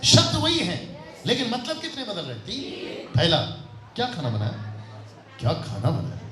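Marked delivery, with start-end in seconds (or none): 4.0-4.8 low, plain, matter-of-fact question; first way of asking. Shown as a demonstration. Teacher showing three tones of one question, low and neutral. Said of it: "तरीका तीन है". 5.4-6.2 low, drawn-back question; second way of asking. Shown as a demonstration. Teacher showing the second tone of one question, low. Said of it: "तरीका तीन है".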